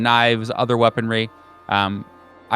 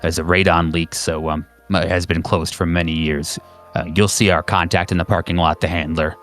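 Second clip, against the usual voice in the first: low-pitched handler giving instructions; brief the agent in a lowered, procedural voice; agency phone operator; 5 semitones lower than usual. Acted. Operator gives caller instructions.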